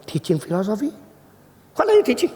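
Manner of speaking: rising, incredulous high question; taken-aback questioning. Retold or imitated. Student questions preacher's claim, high incredulous tone. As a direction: echo it in disbelief, climbing high. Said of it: "dia mulai tanya".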